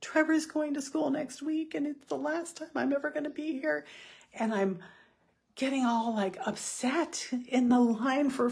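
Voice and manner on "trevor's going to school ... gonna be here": mock panicked lament. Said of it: "I'm suddenly gripped with".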